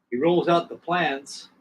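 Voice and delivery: plain reading voice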